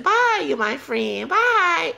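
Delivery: high, slow mocking farewell; mock sing-song bye. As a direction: sing out a taunting goodbye. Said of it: "he said".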